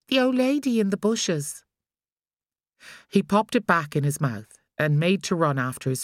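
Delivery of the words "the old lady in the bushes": put-on higher little boy voice, matter-of-fact; child's voice. A narrator's reading. Boy explaining to his mother, childlike. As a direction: say it simply like a small child.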